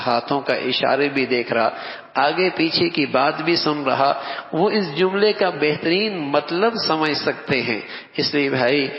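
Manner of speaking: normal talking voice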